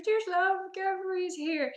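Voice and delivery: high, timid voice lines; small, shy catchphrase delivery; imitated camera-shy cosplayers. Shy cosplayers each giving a line, timid imitation. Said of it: "a little bit camera shy".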